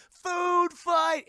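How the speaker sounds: high, loud, drawn-out call; rallying mock shout. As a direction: yell it across a lunchroom, stretching each word. Repeats Paul's rallying cry.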